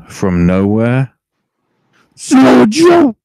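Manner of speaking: wrestling announcer erupting; announcer shout. Acted. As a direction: roar the name like a surprise arrival. Hyping Joe's surprise attack.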